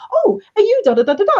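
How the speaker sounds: high, friendly and bright to catch his attention; bright polite call. Retold or imitated. Calling out to the manager who ignored her, bright and high.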